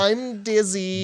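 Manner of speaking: slow, high, pitiful moaning; milk the head injury for sympathy; whiny fake victim. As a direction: Jack playing wounded and helpless, slow and pitiful. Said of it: "being like".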